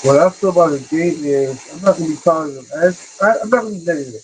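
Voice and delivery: muffled phone line; muffled bass only. Real, not acted. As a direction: voice muffled like a bad phone connection. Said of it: "you sound muffled".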